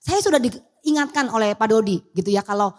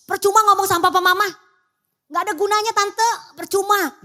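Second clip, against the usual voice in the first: put-on defiant kid; 9 semitones higher, 4 dB louder than usual. Defiant child talking back.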